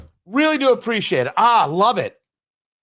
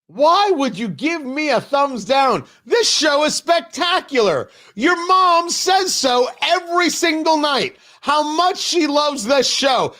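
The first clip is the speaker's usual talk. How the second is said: mock scolding; 9 semitones higher than usual. Host mock-scolding chat user Ozzy.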